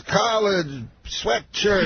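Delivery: high, very slow word-by-word impression; Bill Cosby. Headliner explains his sweatshirt, high halting comic impression. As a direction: drop each word separately, high and slow. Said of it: "He talks, like, just in words".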